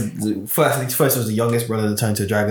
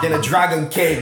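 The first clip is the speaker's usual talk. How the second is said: laughing; 5 semitones higher than usual. Pretend. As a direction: giggle through the punchline. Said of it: "I'll just be like".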